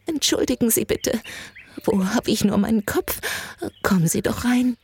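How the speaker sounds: put-on flustered, high old lady voice; brittle old woman. A narrator's reading. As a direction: apologise and invite in, frail and high. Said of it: "Ihre Stimme war so brüchig ... völlig verängstigt".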